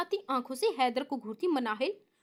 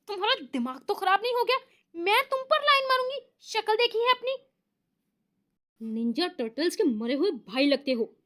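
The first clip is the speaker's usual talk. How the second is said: higher, faster angry outburst; furious; 5 semitones higher than usual. Acted. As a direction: spit the insult out fast. Furious girl insults boy.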